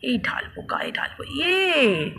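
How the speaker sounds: unvoiced sneaky whisper. Mother creeping up on sleeping husband, whispering. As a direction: whisper it so he won't wake.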